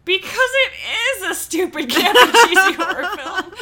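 loud, high, laughing emphasis on IS; delighted punchline breaking into laughter; laughing realization. Retold or imitated. Co-host realizing the campy film really is one, laughing exclamation.

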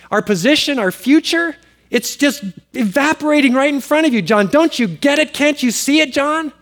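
high, urgent pleading of worried disciples; panicked disciples. Retold or imitated. Disciples urging John in alarm, high and pressing. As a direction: plead with John as if everything is slipping away. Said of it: "his disciples came to him as well and said".

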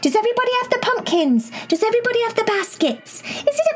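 put-on bubbly, high-pitched little girl; excited high girl voice. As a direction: squeal it out, eager and excited. Cherry voiced in a story.

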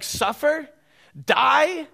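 high, loud sneering parody; mocking Satan's twist. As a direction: spit the words out like a taunt. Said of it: "He's always talked like this".

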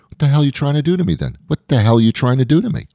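high, fast indignant outburst; outraged disbelief. Pretend. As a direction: protest it, way up and rushing. Imagined outrage at pregnancy rules.

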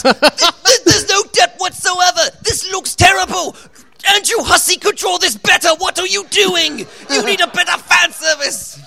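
high, loud, furious mock chef tirade; Gordon Ramsay impression. Panelist mocking an aspiring artist's work, as a screaming celebrity chef. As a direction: scream it like a raging TV chef tearing into a comic. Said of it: "you make it like a Gordon Ramsay".

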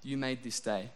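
imagined morning prayer to God, hushed and humble; quiet prayer. Pretend. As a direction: pray it gently before work. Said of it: "and I said".

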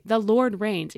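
"The Lord reigns" declared loud, falling; firm declaration. Shown as a demonstration. Preacher urging listeners to speak the truth aloud, firm. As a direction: proclaim "The Lord reigns" to your problem. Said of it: "Think about that issue and then say".

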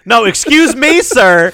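high, loud, prissy fake-polite voice; a hammed-up polite lady talking back; mock politeness. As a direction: sneer it in an overly polite put-on voice.

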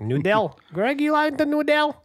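high, loud doting grandma; grandma offering Greg her noodles. Retold or imitated. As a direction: offer noodles like a sweet old lady. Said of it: "She always says".

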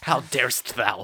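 slow, hammed-up affront; mock-grand, unhurried reproach; mock archaic indignation. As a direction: draw it out like playful old-time outrage. Answers mock offence in archaic words.

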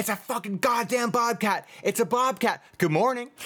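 high mock yell into a chirpy greeting; imitating the looping video. Retelling the looping clip to co-hosts, high mock shout then cheery. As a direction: swing from panic to pleasantness. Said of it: "So he's like".

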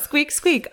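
high squeaking worm noise; squeaky worm. Retold or imitated. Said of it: "Not a mouse".

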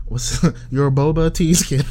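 laughing through the words; breaking into laughter mid-line; giggling while reading a silly letter. Real, not acted.

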